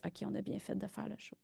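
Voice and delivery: very soft, trailing self-quote; quiet inner thought. Retold or imitated. Filmmaker recalling the moment she felt vindicated, quiet inner thought. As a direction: say it softly, as if to oneself.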